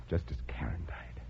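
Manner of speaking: breathy threatening whisper; menacing whisper. Acted. Eric taunts Stan about Karen's death, whispered. Killer gloats over poisoned victim.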